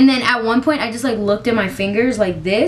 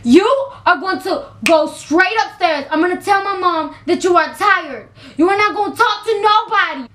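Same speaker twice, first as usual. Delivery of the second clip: cousin giving orders; 8 semitones higher than usual. Retold or imitated. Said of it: "She said".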